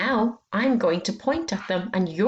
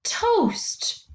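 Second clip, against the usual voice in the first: high modelling word; 13 semitones higher than usual. Shown as a demonstration. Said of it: "Next word".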